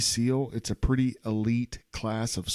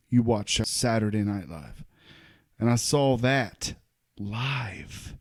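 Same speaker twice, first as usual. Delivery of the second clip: ordinary delivery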